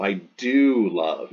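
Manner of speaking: slow, weight on do; deliberate emphatic demo, do pressed hard; emphatic form. Shown as a demonstration. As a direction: land on do as if insisting, I do love. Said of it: "It's emphatic".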